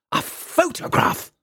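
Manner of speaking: shrieking high in scandalised fury; a shrill, slow outraged exclamation; outraged cry. A narrator's reading. Father recoils at the photograph request, high outraged cry. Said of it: "raised his head furiously".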